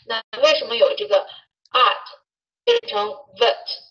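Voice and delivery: normal talking voice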